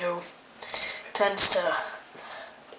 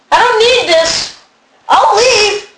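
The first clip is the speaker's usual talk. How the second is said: huffy; 10 semitones higher, 5 dB louder, much slower than usual. Acted. Storming off in mock anger.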